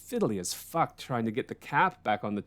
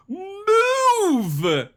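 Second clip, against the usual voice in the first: sudden shrill shouted move; an old lady screaming at a biker; huge yell; 6 dB louder than usual. Retold or imitated. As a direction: bark it out at full force. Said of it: "this huge fucking move".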